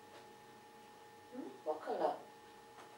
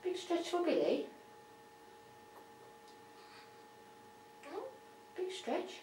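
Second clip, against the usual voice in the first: talking to a pet; 6 semitones higher, 7 dB quieter than usual. Real, not acted. Coaxing pet bird to stretch.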